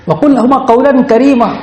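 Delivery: fast, high recitation of the verse; Quranic recitation. Acted. (تیز اور اونچی آواز میں تلاوت)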